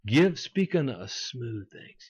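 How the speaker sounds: slow, drawn-out coaxing mimicry; mock smooth request. Preacher voicing people asking false prophets for comfort, mock coaxing. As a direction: stretch "smooth" out long and slick. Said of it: "They tell the false prophets".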